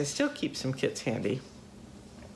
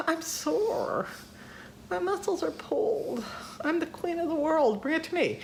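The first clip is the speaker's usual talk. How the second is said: very high, put-on whine; hammed-up high-pitched complaint; mock pitiful whining. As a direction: play the helpless, aching queen in a tiny high voice. Acting out her lazy reply to Doug.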